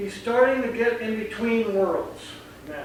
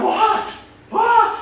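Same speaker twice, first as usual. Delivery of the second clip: high, louder startled "What?"; 6 dB louder than usual. Retold or imitated. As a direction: kid jolted by the yell. And he's going.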